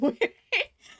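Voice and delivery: sudden high squeal of laughter. Real, not acted. Laughs after repeating joke.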